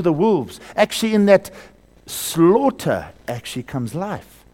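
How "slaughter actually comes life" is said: ordinary talk, nothing marked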